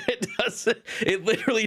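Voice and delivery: breaking into a high laugh mid-word. Real, not acted. Telling viewers about the odd ban, laughing. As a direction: let the laugh take over the words.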